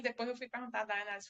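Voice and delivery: ordinary delivery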